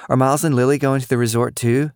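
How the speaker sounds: put-on high, eager little girl; chirpy kid asking a grown-up; girlish voice. A narrator's reading. Cicely asking Lily's mom, high and girlish.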